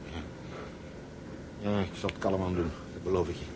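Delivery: low, soft, spent promise; defeated murmur. Acted. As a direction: give in quietly, worn out. Exhausted man gives in. (zacht en laag, uitgeput)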